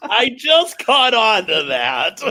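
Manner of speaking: high, loud, slow words through laughter; laughing. Real, not acted. Co-host just catching on to the tease, laughing.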